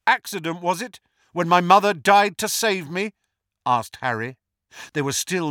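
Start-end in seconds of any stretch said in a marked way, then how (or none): 0.1-3.1 raised, pointed retort; defiant challenge. A narrator's reading. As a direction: fire the word back as a challenge. Harry challenges Voldemort in duel.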